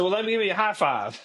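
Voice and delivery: a light mock cheer offering the high five; cheery high five. Celebrating with patient.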